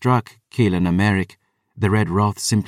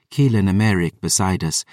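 plain reading voice

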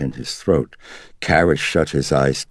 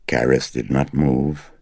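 ordinary delivery, nothing marked

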